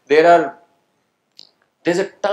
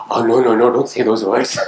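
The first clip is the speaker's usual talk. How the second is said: laughing protest; 5 semitones lower, 4 dB louder than usual. Real, not acted. Student says something cheeky, speaker reacts.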